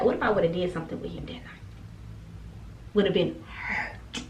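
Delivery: fast whispered what-if; hushed whisper. Real, not acted. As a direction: drop to a whisper for the scary thought. Imagining the worse outcome.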